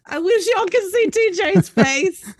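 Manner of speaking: high, loud, laughing burst; laughing squeal. Real, not acted. Laughing to listeners about the host's shocked face, a loud high squeal.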